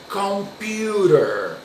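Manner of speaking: "computer" drawn out slowly, "pu" as "pyoo"; slow English demo. Shown as a demonstration. Teacher models "computer" slowly for students. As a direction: stretch out "computer" for the class. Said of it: "um som mais literal da letra U".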